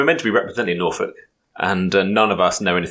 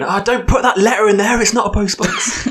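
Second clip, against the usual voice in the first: mock shout; 11 semitones higher than usual. Mock quote of someone shouting.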